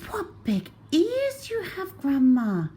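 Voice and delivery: put-on high, wondering child voice; girl in a child voice; little girl. A narrator's reading. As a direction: marvel like a small child. Girl to wolf.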